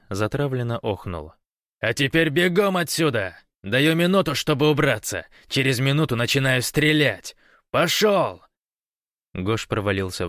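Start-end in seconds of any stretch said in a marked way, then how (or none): 1.8-8.4 shouting orders. A narrator's reading. Gosh chasing Vovan off, threatening.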